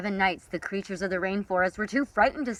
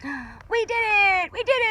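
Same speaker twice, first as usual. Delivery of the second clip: very high, slow triumphant cry; joyful cheer; 14 semitones higher, 4 dB louder, slower than usual. Acted. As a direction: cheer the victory. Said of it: "shouted the night creatures".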